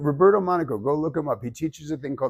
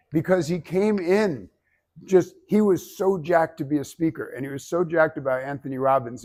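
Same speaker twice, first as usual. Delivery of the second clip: ordinary talk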